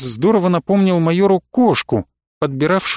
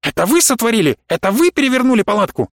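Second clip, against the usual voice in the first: higher-pitched outraged questions; indignant accusation; 6 semitones higher, faster than usual. A narrator's reading. Professor accusing the colonel of wrecking his tent, indignant. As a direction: accuse them in affronted disbelief.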